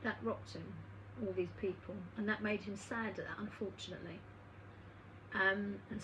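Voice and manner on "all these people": usual speaking voice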